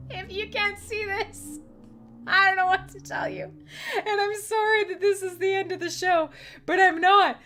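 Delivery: can barely get the words out for laughing; laughing through words. Real, not acted. Laughing while closing show.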